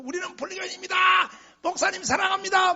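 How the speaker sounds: high, excited fan cry; mock Buddhist fans. Buddhists greeting the pastor, high excited fan cry. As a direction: squeal it like adoring fans.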